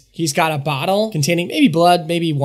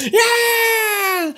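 long, very high "yeah" scream; drunk crowd scream. Acted. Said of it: "The drunk people scream".

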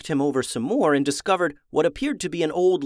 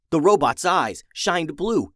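ordinary delivery, nothing marked

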